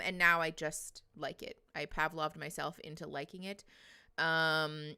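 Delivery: normal talking voice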